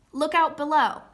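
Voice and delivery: loud, high shout from above; urgent look out below; warning call. Shown as a demonstration. Reading an exclamatory command example.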